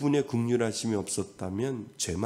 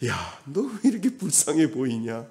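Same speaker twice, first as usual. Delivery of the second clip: a lightly voiced, higher, pitying God; God's pity; 5 semitones higher, 3 dB louder than usual. God asks a weeping believer why, with mild pity. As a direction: ask the crying one, gently amused.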